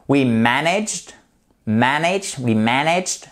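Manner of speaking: MANaged, stressed on the first syllable. Shown as a demonstration. Teacher modelling word stress, "managed" stressed on MAN. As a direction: punch MAN in "managed", carefully spaced. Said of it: "Accent tonique sur la première syllabe".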